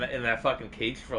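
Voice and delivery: usual speaking voice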